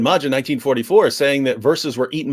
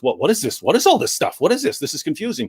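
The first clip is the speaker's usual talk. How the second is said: mock bewilderment; 2 semitones higher, faster than usual. A beginner meets the variant readings for the first time, baffled. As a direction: act out a confused beginner.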